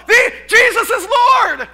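loud, slow, high proclamation; preaching to a crowd in the street; preacher calling out. Retold or imitated. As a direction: call it out to the square.

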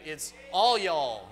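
Tennessee y'all. Shown as a demonstration. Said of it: "we have the y'all down there in Tennessee".

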